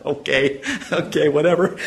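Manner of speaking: mock-casual okay, okay, whatever, said lightly; dismissive shrug. Preacher playing Abraham brushing off God's promise, dismissive. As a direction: brush it off with a half-laugh. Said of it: "That's his attitude".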